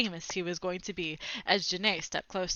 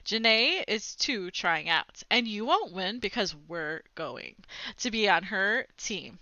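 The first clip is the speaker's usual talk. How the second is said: firm warning; slower than usual. Acted. Friend stepping up to warn.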